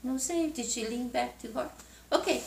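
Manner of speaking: ordinary delivery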